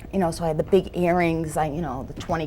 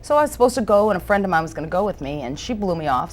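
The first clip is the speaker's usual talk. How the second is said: ordinary delivery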